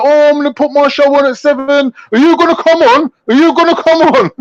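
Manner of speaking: high, mocking voice of the rival; squeaky taunting mimicry; mocking impression. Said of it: "It was like".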